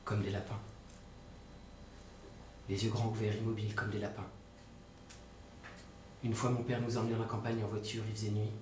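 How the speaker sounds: low, soft monotone recollection; stunned, numb. Acted. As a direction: recount it haltingly, in shock, with long pauses. Stunned brother recounts what he saw. (récit sous le choc, voix basse et éteinte)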